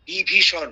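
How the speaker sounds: "বিভীষণ" loud and crisp, every sound clear; crisp pronunciation. Shown as a demonstration. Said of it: "উচ্চারণগুলো লক্ষ্য করুন".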